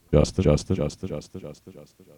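name echoing off into the distance; low call, rapid repeats fading away; echo effect. Shown as a demonstration. Calling Justin to demo an effect, fading echo. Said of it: "what about this?".

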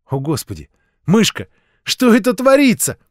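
a mother taken aback by the mess; what on earth is going on; startled mother. A narrator's reading. Mother startled by child's raid.

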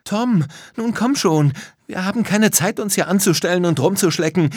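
put-on high, loud girl urging a friend on; pressing, high-pitched shout; urgent call. A narrator's reading. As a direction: call out impatiently. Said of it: "rief Lisa".

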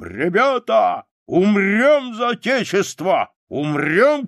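high, loud, drawn-out rallying shout; rally the troops, stretching the words; imitated general's shout to his men; battle cry. A narrator's reading. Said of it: "и кричит он нам".

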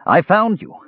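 high, sudden burst of feeling; emotional blurt. A narrator's reading. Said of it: "Tanis blurted".

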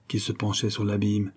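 usual speaking voice

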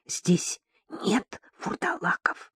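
soft, voiceless whisper. A narrator's reading. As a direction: whisper it, barely audible. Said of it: "Татьяна по-прежнему шептала".

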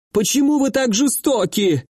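high, whining reproach; plaintive victim on the edge of tears; tearful whine. A narrator's reading. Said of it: "В его голосе теперь звучали плаксивые нотки".

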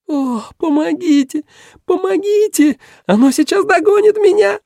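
put-on very high frantic girl's squeal. A narrator's reading. Girl flees a pursuer calling for rescue, frantic squeal. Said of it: "визжал девичий голос".

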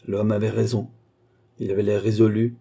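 ordinary delivery, nothing marked

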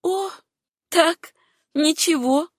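faltering voice. A narrator's reading. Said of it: "почти прерывающимся голосом ответила".

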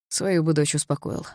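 ordinary delivery

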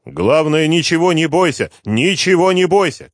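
high, loud urgent shout. Acted. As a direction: shout reassurance down the line. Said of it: "кричу я ей".

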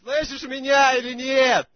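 high, slow, loud shout. Real, not acted. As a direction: yell it so she finally hears. Shouting down the phone.

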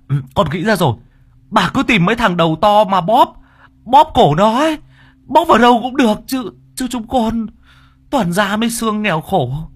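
high, loud stammering plea; glib frantic babbling. A narrator's reading. Worker bargaining with the spirit to spare his crew, frantic glib pleading. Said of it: "Thằng Long mồm liến thoáng".

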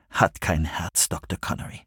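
leiser, düsterer Ton, der ins Flüstern fällt; düster, leise. A narrator's reading. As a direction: sprich es düster und leise, am Ende nur gehaucht. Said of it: "sagte er düster". (leise und düster, zuletzt geflüstert)

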